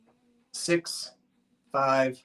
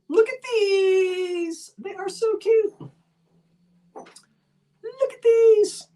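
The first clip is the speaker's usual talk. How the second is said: put-on much higher, slow, drawn-out baby-ish cooing; little tiny voice; much slower than usual. Seller cooing over tiny glass creamers, little tiny voice. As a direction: coo over them in a teeny little voice. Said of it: "I'm doing my little tiny voice".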